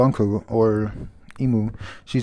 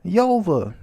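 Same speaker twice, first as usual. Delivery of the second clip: high shout of names; mother yelling names across the battlefield; 9 semitones higher, slower than usual. Retold or imitated. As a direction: call out to them, much higher. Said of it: "she's yelling at them".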